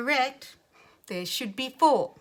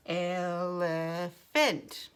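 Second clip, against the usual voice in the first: ELEPHANT very slow, in three level chunks; robot talk; 2 semitones lower, much slower than usual. Shown as a demonstration. Teacher doing it again with the class, slow chunked robot ELEPHANT. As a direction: draw out each syllable of ELEPHANT like a robot. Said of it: "like we are a robot".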